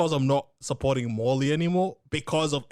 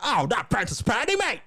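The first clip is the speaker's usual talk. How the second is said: mock Australian accent; 8 semitones higher than usual. Teasing the co-host about Australian soaps, in a mock Aussie accent. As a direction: tease the other host in a broad Aussie voice. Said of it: "your Australian accent coming in, mate".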